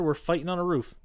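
usual speaking voice

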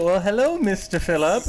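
flirty high voice. Acted. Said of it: "She's like".

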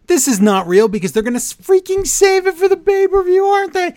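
very high, loud, rushed outburst; exasperated rant. Real, not acted. Fan fed up with a fake match announcement, exasperated high rant. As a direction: climb into a squealing pitch of exasperation.

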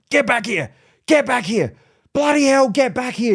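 high, exasperated mock yell; imitated yelling. Owner to dog chasing a runner, imitated yelling. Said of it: "the owner is yelling".

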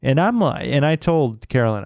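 ordinary talk

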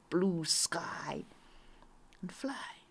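fading, soft whispered narration. A narrator's reading. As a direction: let it float away in a whisper. Whispered story phrase.

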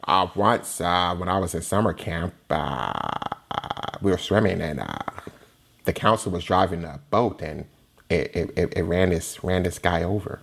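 low, quiet, halting recollection; Goldblum-style answer. Retold or imitated. A timid lawyer answering Barbosa, low and halting. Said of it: "Jeff Goldblum with one of Goldblumisms".

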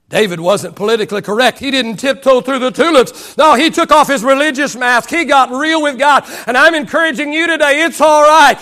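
impassioned sermon delivery; rolling pulpit cadence; preaching. Real, not acted. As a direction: preach it with driving force, stretching key words. Preaches at full force.

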